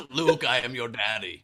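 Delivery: laughing Darth Vader parody; fan-warped Vader voice, giggling. Pretend. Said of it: "talk into the fan and it would, like, modulate your voice".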